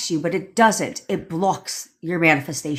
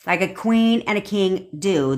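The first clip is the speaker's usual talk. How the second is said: normal talking voice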